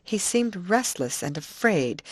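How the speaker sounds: ordinary delivery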